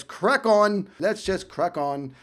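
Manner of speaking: slow, playful English accent; put-on English accent, taking its time. Shown as a demonstration. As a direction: drop into an amateur English accent, drawn out. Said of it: "throwing in a little bit of an English accent".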